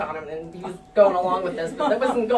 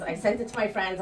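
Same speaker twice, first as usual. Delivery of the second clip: ordinary talk, nothing marked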